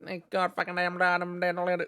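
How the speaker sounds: high-pitched string of swearing, hammed up; playful impression of Australians swearing at each other; mock Aussie swearing. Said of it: "sounds really, intimidating".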